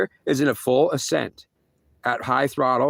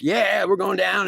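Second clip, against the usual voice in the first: mock scream; 7 semitones higher, slower than usual. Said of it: "screaming like".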